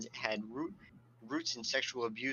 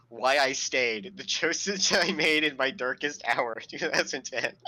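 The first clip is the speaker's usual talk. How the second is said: loud, cracking up through the words; real laughter breaking up the sentence; laughing while reading; 3 semitones higher, 6 dB louder than usual. Real, not acted. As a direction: struggle to read it out through laughter. Breaks up reading the book titles.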